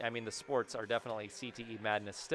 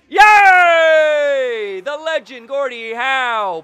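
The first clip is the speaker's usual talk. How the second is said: high, loud victory yell; triumphant shout; 11 semitones higher, 19 dB louder, much slower than usual. Real, not acted. Gamer celebrating an overtime winner, triumphant yell. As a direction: roar it like a goal call.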